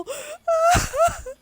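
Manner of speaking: high wheezing laugh near tears; tearful laugh. Real, not acted. Streamer losing it over the game scene, tearful laugh. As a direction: laugh until it turns to crying. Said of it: "I'm crying".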